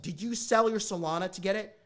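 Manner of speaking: loud, incredulous demand; almost yelling the worry at her; alarmed loud question. Retold or imitated. Son asks mom on the phone about selling crypto, loud alarm.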